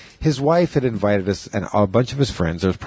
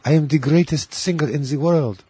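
higher, grand diva voice; 6 semitones higher than usual. Retold or imitated. Quoting a comedian's sketch, higher mock-grand diva voice. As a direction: belt it out like a self-important diva. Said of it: "used to do an awesome [name]".

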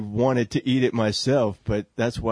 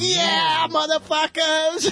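rowdy shout; much slower than usual. Pretend. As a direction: scream it like a punk crowd. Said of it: "They're like".

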